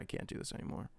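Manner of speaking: breathless defeated whisper. Retold or imitated. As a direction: whisper it like giving up. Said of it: "I was like".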